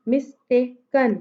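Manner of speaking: "mistaken" in three slow beats, mis-tay-ken; teacher slowly sounding out "mistaken"; syllable by syllable. Shown as a demonstration. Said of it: "three syllables".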